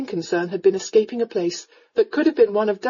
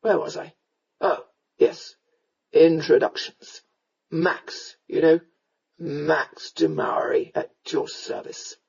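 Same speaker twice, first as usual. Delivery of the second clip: slow, low tipsy introduction; drunken rogue, muddled courtesy; 4 semitones lower, slower than usual. A narrator's reading. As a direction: introduce yourself grandly but fuzzily. Drunken rescuer introduces himself.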